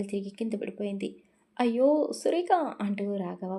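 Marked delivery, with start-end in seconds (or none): 1.6-2.5 high, loud cry of alarm. A narrator's reading. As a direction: cry out as she collapses. Brother runs to fallen sister. (కంగారుగా కేక)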